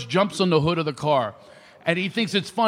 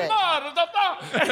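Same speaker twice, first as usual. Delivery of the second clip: high, slow curse broken by laughter; laughing curse; 13 semitones higher, much slower than usual. Retold or imitated. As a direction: start a curse and crack up. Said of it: "the guy's got cherries all over".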